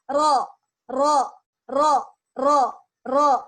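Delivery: drilling "Ro" with a buzzing trill; rolled R demo. Shown as a demonstration. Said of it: "dia miringnya tetap di atas".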